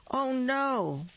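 high alarmed squeak; startled cry. Acted. Bird sees something flopping at him, high alarmed cry. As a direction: yelp it in sudden alarm.

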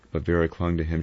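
usual speaking voice

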